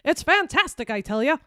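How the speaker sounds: high, loud transatlantic accent; old Hollywood transatlantic accent. Shown as a demonstration. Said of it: "with a transatlantic accent, like the fifties".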